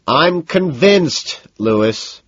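high, slow exasperated shout. A narrator's reading. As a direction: shout it at him, stretching it out. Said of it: "I shout at him".

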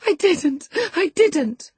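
sobbing denial. A narrator's reading. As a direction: deny through sobs. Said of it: "sobbed Cathy, fit to break her heart".

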